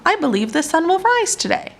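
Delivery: high, fast hopeful voice; lightly voiced owl, sure of the dawn. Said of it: "said Owl".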